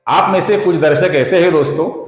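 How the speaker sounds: usual speaking voice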